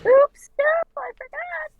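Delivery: high squeaky mock oops; silly high voice. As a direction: squeak it like a guilty kid. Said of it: "And I'll be like".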